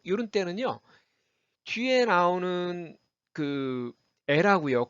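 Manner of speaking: ordinary delivery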